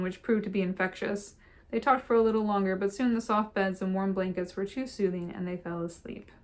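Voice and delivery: ordinary delivery, nothing marked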